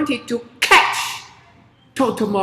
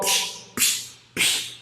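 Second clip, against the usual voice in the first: whispered splashing; 6 dB quieter, slower than usual. Acted. Splashing sound.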